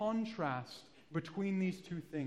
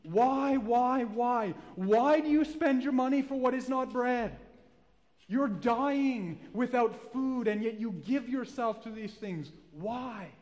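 loud, high, anguished pleading; desperate loud plea, dragging out the dying line; 6 semitones higher, 6 dB louder, slower than usual. Acted. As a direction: beg them to stop, crying out why. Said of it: "he begins to plead with them".

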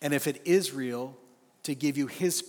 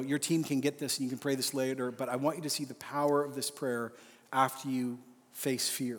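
usual speaking voice

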